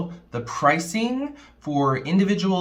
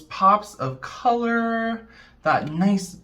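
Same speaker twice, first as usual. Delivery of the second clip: usual speaking voice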